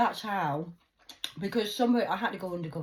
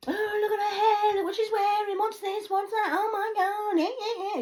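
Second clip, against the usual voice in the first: much higher, louder catty gossip voice; mocking judgmental viewers; 8 dB louder than usual. Mocking judgmental viewers picking at her looks, catty. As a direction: sneer like a gossiping critic. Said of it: "'Cause you're all judgmental".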